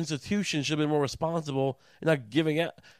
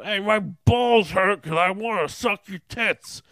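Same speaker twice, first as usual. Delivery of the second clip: loud, slow put-on whine; whiny macho guy; 5 semitones higher, 5 dB louder, slower than usual. Mocking the macho man's idea of suffering, loud put-on whine. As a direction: whine like a frustrated lout.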